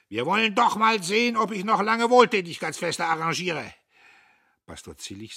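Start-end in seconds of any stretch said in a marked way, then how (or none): 0.1-3.7 loud, much higher bellow; roaring major. Acted. The major bellows threats at the town: loud roar. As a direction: roar it out in defiance. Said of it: "Der Major brüllte".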